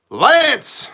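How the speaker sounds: loud, high shout of the name; excited shout. Real, not acted. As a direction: yell it like you spot a friend. Greeting a chatter by name.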